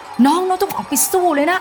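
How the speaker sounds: high, rallying coach call to a player; football coach. Pretend. Football coach urging young player onto pitch, high rallying call. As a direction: pump up your player like a coach on the sideline. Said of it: "โค้ชนักฟุตบอล เราจะโค้ชเขาให้".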